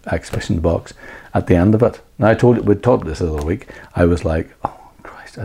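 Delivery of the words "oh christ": breathy whispered exclamation. Retold or imitated. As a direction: whisper it in shock. Retold inner panic, whispered.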